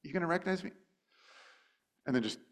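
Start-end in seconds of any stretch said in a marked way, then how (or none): 0.0-0.7 teasing Jesus. Retold or imitated. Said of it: "maybe he gave him a minute".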